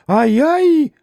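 put-on high startled cry of an old woman; shriek of fright. A narrator's reading. Old servant shrieks as Mitya yells at her, high startled cry. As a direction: yelp it, high and sudden. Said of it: "закричала старушонка".

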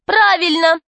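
high-pitched cry of agreement; girl's shout. A narrator's reading. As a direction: call out emphatically in support. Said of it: "крикнула Юлька".

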